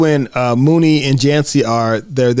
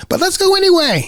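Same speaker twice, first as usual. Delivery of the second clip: pitched up, playful dare; high mischievous kid. Retold or imitated. Kid leading friends into forbidden rooms, high and playful. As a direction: tempt your friends like a little troublemaker. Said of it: "Mooney says".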